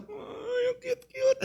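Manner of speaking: high, doting ay, ang cute-cute; doting squeal. Acted. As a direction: gush like a proud dad. Father doting on son.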